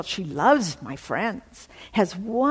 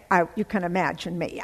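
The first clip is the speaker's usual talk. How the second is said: ordinary talk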